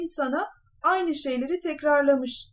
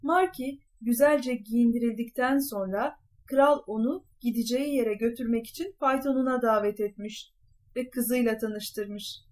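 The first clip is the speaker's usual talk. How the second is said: ordinary delivery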